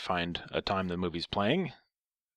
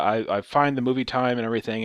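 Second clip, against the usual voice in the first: usual speaking voice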